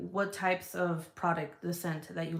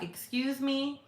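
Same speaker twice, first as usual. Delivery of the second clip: drawn-out, higher indignant question; mock indignation; 6 semitones higher, slower than usual. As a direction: act out confronting a husband, slowly. Retells confronting husband over cologne.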